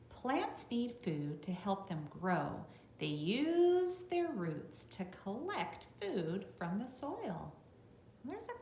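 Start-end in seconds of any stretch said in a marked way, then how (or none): 0.1-7.5 louder, slow storybook read; bright read-aloud voice for a class; reading to children. Acted. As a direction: read it out clearly for the little ones. Reads the book text to children.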